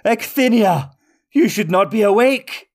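high, alarmed villain cry; startled by the witch; frightened wizard. A narrator's reading. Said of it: "palpable fear in his eyes".

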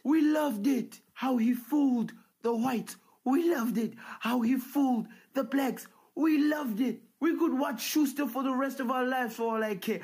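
loud, high, gleeful chant of fans; mock gleeful crowd. Fans cheering Schuster fooling both races, loud and high. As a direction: cheer it like a crowd that loves the prank.

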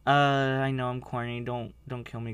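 ordinary talk

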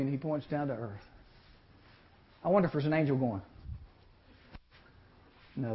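ordinary delivery

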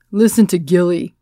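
lightly shouted from across the room; mild mock shout. A narrator's reading. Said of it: "a woman from the back of the room shouted".

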